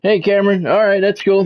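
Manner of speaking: usual speaking voice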